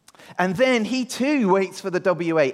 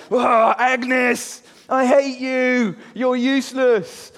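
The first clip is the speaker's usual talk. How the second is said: high, loud, drawn-out mock tantrum; angry shout; 6 semitones higher, 5 dB louder, much slower than usual. Andrew yelling back at his teasing sister, acted fury. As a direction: explode at your sister, hammed up. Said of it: "He gets angry".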